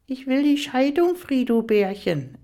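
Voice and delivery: ape the mistress's high whine; sneering squeaky imitation of a rival; mocking mimicry. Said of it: "äffte sie".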